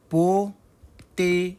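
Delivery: POTATOES broken into slow, separate syllables with clear T's; British pronunciation. Shown as a demonstration. Teacher sounding out a British English word, slow syllables. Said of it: "En anglais, British".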